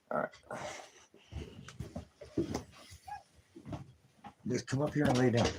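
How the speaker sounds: high, soft cooing; pet talk. Real, not acted. Owner coaxing the kitten to lie down, high cooing. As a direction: sweet-talk the kitten up onto the couch.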